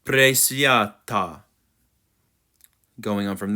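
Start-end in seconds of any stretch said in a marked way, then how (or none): 0.1-1.4 "Presviata" slowly, pryeh-svyah-tah; slow pronunciation model. Shown as a demonstration. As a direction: stretch "Presviata" syllable by syllable. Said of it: "if you practice it".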